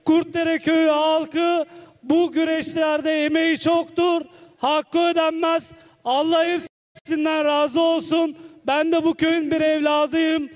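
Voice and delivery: usual speaking voice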